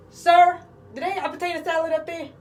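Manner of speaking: high, loud call up the line. Retold or imitated. Asking the funeral line about potato salad, loud high call. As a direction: shout politely up to the front.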